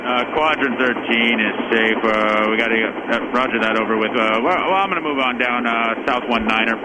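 crackly military radio. Acted. Helicopter radio chatter call signs.

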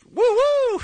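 high, loud whooping cheer; excited whoop. Retold or imitated. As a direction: whoop it up like a fan. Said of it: "the other half were".

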